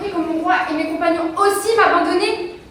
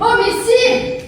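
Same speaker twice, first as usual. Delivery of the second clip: shocked; 6 semitones higher, much slower than usual. Acted. Shocked girl protests.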